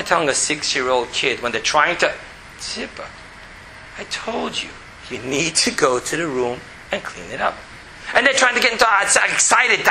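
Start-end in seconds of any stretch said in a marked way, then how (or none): 2.6-7.6 much lower, softer, calm and even; tone brought down. Shown as a demonstration. Father telling his daughter to clean her room, low and calm. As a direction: keep it low and steady, no yelling. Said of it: "bring your tone really down".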